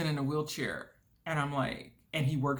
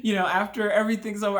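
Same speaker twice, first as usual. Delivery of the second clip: ordinary talk, nothing marked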